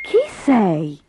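a touch of breathless wonder; lightly acted, out of breath with amazement; breathless question. A narrator's reading. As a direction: read as a breathless boy's question. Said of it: "chiese Michele senza fiato".

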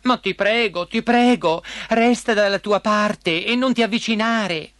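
high, fed-up princess voice; exasperated pleading. A narrator's reading. Princess letting frog up but keep away, exasperated. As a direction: give in with a huff, then plead.

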